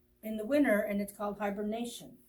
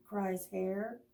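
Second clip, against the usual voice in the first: plain reading voice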